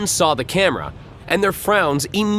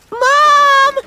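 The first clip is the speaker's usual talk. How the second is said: put-on much higher, much louder kid yell; 10 dB louder than usual. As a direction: call out loud for his mother. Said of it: "the boy yelled".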